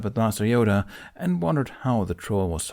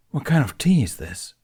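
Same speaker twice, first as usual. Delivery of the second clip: higher, louder disbelieving question; aghast; 6 semitones higher, 4 dB louder than usual. Acted. Ben asking Yoda about the tea, aghast. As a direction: recoil from the taste and demand an answer.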